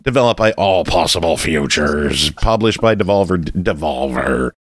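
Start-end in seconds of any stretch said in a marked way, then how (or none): 0.0-2.3 low pirate voice reading a credit. Pretend. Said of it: "Okay, I gotta stop". 3.5-4.5 low, slow pirate voice. Pretend. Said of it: "Okay, I gotta stop".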